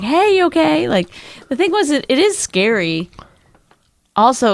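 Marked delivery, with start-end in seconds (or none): 0.0-0.9 very high, bright pretend concern; mock caring rescuer. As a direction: play the kind rescuer nobody was. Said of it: "Nobody was like".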